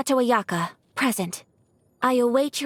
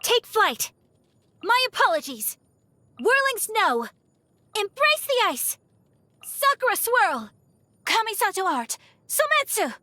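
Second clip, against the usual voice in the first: high-pitched attack call-outs; battle cries; 10 semitones higher than usual. Acted. Swordswoman announcing her attacks in combat, high battle cries. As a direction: call each move out high and sharp like a strike.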